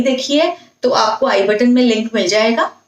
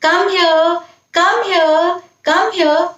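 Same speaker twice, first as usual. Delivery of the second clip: put-on tender mother calling her toddler; lovingly; 6 semitones higher, much slower than usual. Shown as a demonstration. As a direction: coax a baby toward you. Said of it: "कैसे उसको आप प्यार से बोल सकते हैं".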